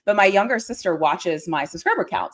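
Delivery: normal talking voice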